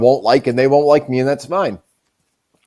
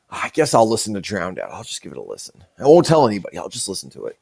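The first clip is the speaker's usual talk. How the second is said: sheepish mutter; 7 semitones lower, 6 dB quieter than usual. Retold or imitated. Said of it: "some of the Juggalos would be like".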